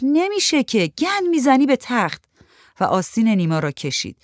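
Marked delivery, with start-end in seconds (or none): 0.0-2.2 high-pitched scolding squeal; Shahrzad, shrieked protest. A narrator's reading. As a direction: object in an alarmed high voice. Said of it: "شهرزاد جیغ کشید و گفت".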